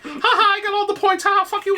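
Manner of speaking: very high, taunting villain gloat; Joker. Acted. Player gloats over beaten kid, Joker voice. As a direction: crow with mocking villain glee. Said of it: "Like that".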